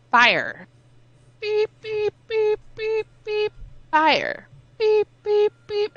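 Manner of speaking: high-pitched mock alarm beeping; imitating a fire alarm. Telling friends about the haywire alarm, mock alarm beeping. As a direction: squeal out the beeps like a smoke detector. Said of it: "be like".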